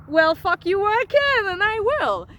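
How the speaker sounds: loud, high defiant outburst. Real, not acted. Answering the ex-husband's doubt about driving stick, defiant. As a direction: fire it back at full voice, insistent.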